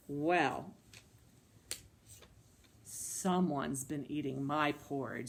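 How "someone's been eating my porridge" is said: low storybook bear; deep-pitched Papa Bear for small children. Acted. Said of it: "make a Papa Bear voice".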